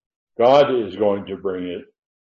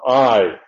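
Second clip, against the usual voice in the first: "I" hit hard and falling; emphatic I; 5 dB louder than usual. Shown as a demonstration. Preacher showing the emphatic pronoun, pulpit-style stress on "I". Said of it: "that's found in the pulpit I".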